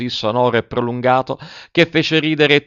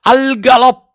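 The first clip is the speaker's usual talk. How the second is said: much higher, louder yell; shouted command; 14 semitones higher, 7 dB louder than usual. Acted. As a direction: bellow the order across the ring. Director calls out orders. (urlo acuto e forte)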